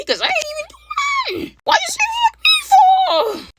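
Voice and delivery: hammed-up indignant wail at the friend; slow high squawk of fake indignation; mock outraged shout.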